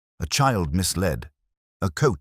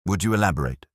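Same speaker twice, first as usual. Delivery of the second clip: polite, slightly raised inquiry; a courteous, measured prompt; 4 semitones higher, much faster than usual. A narrator's reading. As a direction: ask it courteously, pitched a little higher. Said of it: "Pirbright's tone remained courteous".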